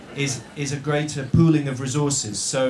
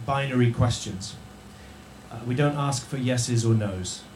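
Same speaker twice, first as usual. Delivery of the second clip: normal talking voice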